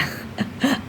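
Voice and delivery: quiet, breathy little laugh; soft chuckle. Real, not acted. Teacher amused at her reversed example, soft chuckle. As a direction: chuckle softly to yourself.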